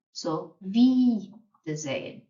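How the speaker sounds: "Wiedersehen" said slowly, starting with a V and a long E; slow it right down to show the V; careful pronunciation. Shown as a demonstration. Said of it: "So the W is a V sound".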